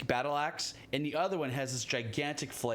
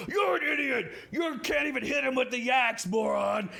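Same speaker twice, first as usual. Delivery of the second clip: loud, higher yelling at his other head; mock angry insult; 9 semitones higher, 5 dB louder than usual. Ettin head shouting at the other head for missing. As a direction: scream it at your partner like a fool. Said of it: "someone's like".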